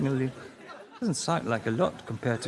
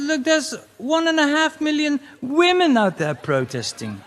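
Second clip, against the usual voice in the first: self in quote; 12 semitones higher, 5 dB louder than usual. Acted. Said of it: "I said".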